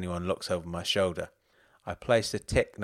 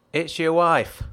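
higher, louder call across the shop; 7 semitones higher, 7 dB louder, slower than usual. Acted. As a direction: call it out from behind the counter. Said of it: "someone called from behind the counter".

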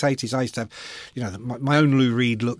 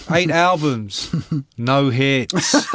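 [name] gag; 3 dB louder, much slower than usual. Retold or imitated. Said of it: "He said".

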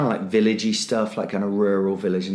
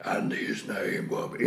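slow breathy whispered prophecy; eerie whisper; 8 dB quieter, slower than usual. Retold or imitated. Eerie voice heard as the figure turns, whispered. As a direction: breathe it out like a ghostly omen.

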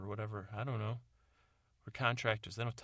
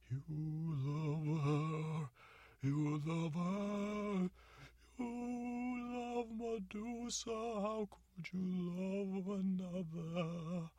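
high, slow, wailing jealousy; jealous wailing gorgon; 9 semitones higher, much slower than usual. Acted. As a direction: wail it out, heartbroken and jealous.

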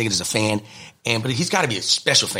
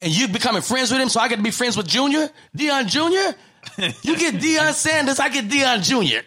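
much higher, squealing with glee; excited high squeal; 13 semitones higher than usual. Retold or imitated. Friend squealing excitedly about meeting Deion Junior. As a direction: squeal like a starstruck fan. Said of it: "He said".

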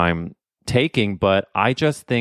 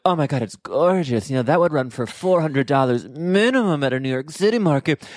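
high, gushing delight; 6 semitones higher than usual. Retold or imitated. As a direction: gush over the find. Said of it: "And we were like".

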